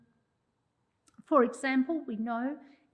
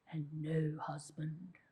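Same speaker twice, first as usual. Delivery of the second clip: whispered aside; 8 semitones lower, 8 dB quieter, slower than usual. Acted. Dramatic reveal in the story.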